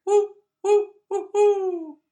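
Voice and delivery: high, loud hooting whoo; imitated owl hoot. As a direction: hoot like an owl outside the window. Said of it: "I hear".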